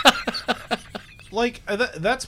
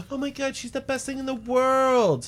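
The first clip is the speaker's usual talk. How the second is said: mock fan gush; 8 semitones higher than usual. Mocking fans of [name].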